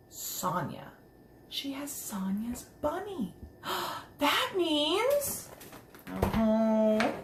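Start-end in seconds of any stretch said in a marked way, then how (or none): 0.1-3.3 hushed reveal. Real, not acted. Dramatic reveal of owner. 3.4-4.1 quick breathless gasp; soft gasp. Real, not acted. Reader gasps at realization.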